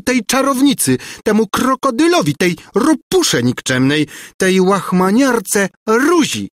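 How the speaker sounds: high, vengeful string of insults; screeching tirade. A narrator's reading. Governess cursing her rival, screeching. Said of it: "skrzeczała za nim Gburja Furia".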